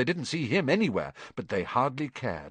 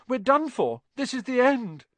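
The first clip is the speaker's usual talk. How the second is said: high-pitched panicky despair; hopeless wail; moaned. A narrator's reading. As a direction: moan that all is lost. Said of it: "Ron moaned".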